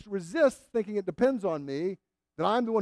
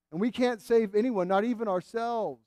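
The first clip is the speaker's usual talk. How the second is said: usual speaking voice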